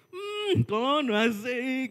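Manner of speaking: high, slow, mock tipsy auntie; mock dancing auntie. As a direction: drawl it out like a grooving auntie. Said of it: "dia ngomong gini loh". (tiruan tante berjoget, tinggi dan lambat)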